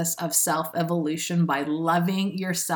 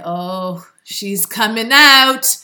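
sing-out hype, much louder at the end; gleeful announcement; 3 semitones higher, 10 dB louder, much slower than usual. Acted. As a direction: call it out like a big reveal, stretching the words. Quotes her own gleeful announcement.

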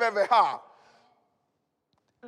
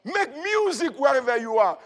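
normal talking voice

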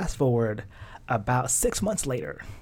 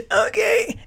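high, loud squeak through mock tears; hammed-up sobbing little reply; tearful agreement; 5 dB louder than usual. Daughter giving in to mom's advice, tearful agreement. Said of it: "through the tears".

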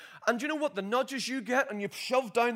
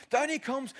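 usual speaking voice

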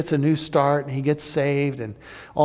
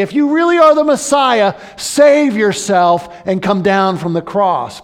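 loud, high sneering jeer; mocking taunt; 6 semitones higher, 10 dB louder than usual. Religious leaders jeer at Jesus on the cross, loud and mocking. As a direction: hurl it up at the cross with scorn. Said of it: "they taunt him and they say".

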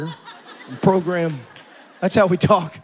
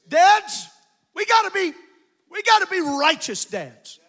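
high, loud call to the congregation; exhorting preacher; 10 semitones higher, 7 dB louder than usual. Acted. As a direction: call the fathers out from the pulpit. Preacher calls out to fathers.

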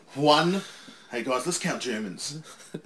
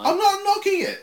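defensive protest; 8 dB louder than usual. Real, not acted. Defending himself in banter.

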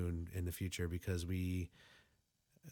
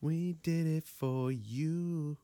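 slow, drawn-out and higher; 5 semitones higher, much slower than usual. Real, not acted. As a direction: stretch every word out slowly. Slow drawn-out repeat of whisper.

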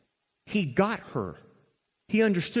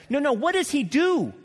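normal talking voice

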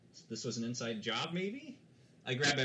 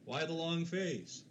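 lightly acted, higher and slow; villager's quip; 6 semitones higher, slower than usual. Villager inside answering the horse at the door, slow higher-pitched quip. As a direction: stretch the joke out in a raised voice. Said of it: "someone inside says".

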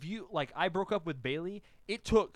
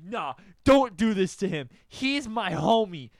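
loud, higher, emphatic protest; fierce defending; 4 semitones higher, 10 dB louder than usual. Retold or imitated. Friend defending sad boy to Carrie, loudly protective. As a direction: defend your friend at full volume.

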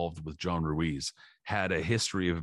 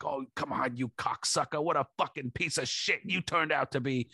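mock ranting trainer; 6 semitones higher than usual. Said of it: "Stoney's losing his shit".

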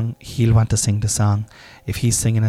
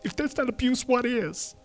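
higher whining voice of a grown son; imagined adult son complaining about dad's affirmations, higher and aggrieved; future grown son; 12 semitones higher than usual.

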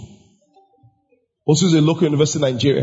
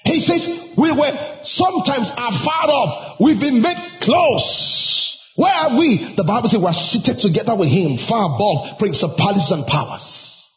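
high-pitched, drawn-out sermon delivery; preaching; 7 semitones higher than usual. Real, not acted. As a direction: preach it with full conviction to the congregation. Preaches to congregation.

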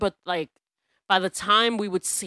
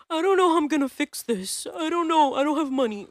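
higher, worried landlady voice, mocked; imitating the landlord; 8 semitones higher than usual. Mocking the landlord's panic about money, higher. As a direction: whine it like a helpless landlord. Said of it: "she comes down and she's like".

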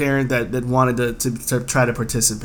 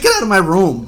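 retold yell; 6 semitones higher, 4 dB louder than usual. Retold yell at his dad.

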